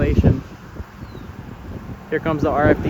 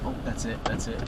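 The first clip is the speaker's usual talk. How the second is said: hushed awe; 3 semitones lower, 12 dB quieter than usual. Real, not acted. First sight of the tornado.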